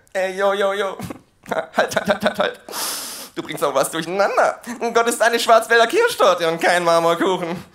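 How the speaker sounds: lazy mumbling rapper voice; [name] voice. Acted. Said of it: "Er spricht irgendwie wie [name]".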